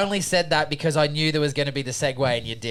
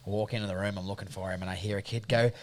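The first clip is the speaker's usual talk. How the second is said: ordinary delivery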